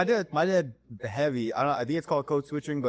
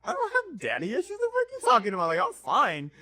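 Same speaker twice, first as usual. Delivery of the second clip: much higher, squeaky indignant denial; indignant disbelief; 12 semitones higher than usual. Retold or imitated. Denying daddy issues to mom, shocked and high. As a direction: act baffled at the accusation, way up high. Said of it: "looked at her like".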